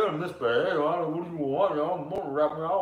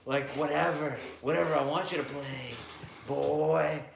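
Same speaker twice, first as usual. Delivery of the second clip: creepy Louisiana guy; 5 semitones lower, 3 dB quieter than usual. Acted. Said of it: "That's creepy Louisiana guy for you guys".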